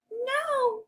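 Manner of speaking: very high, squeaky little pig; high-pitched piggy voice. Acted. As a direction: squeal a refusal as a tiny pig. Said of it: "the two little pigs both say".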